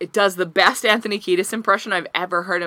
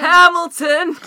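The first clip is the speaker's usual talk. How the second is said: excited callout; 10 semitones higher, 6 dB louder, much slower than usual. Real, not acted.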